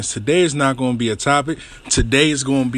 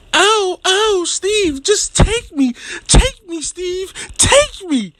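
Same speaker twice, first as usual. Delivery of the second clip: put-on very high falsetto swooning girl; breathy-sounding high mock female voice; swooning woman; much slower than usual. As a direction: play the lovestruck girl begging.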